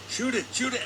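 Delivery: high-pitched imitation of urging a shooter; urgent urging.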